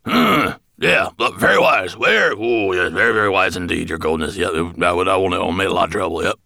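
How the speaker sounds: hurried, repetitive dwarf muddle; fawning babble. A narrator's reading. Dwarf placating his dragon master, fawning. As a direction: agree eagerly, tripping over yourself.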